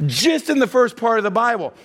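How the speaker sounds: ordinary talk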